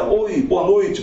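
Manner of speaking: usual speaking voice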